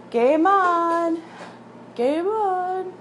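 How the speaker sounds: high, drawn-out sing-out; Wayne's World call. Retold or imitated. Street hockey players resume after a car passes, calling out. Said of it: "have you seen Wayne's World?".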